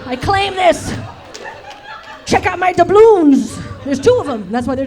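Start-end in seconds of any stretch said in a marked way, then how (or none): 0.0-3.6 growl, then a loud boast; hammed-up pirate staking his claim, building to a shout; Captain Morgan. Guy from the bathroom as a pirate, loud and boastful. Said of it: "just like a Captain Morgan".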